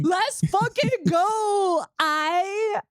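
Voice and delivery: a giddy hype cheer; real excitement; excited. Real, not acted. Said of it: "I'm so excited".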